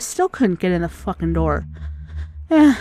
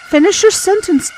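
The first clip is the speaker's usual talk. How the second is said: higher, loud pleading yell; frustrated shout; 9 semitones higher, 4 dB louder than usual. Real, not acted. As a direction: yell it at the screen. Yelling at dying character.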